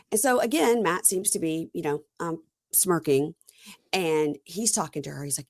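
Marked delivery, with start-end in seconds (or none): none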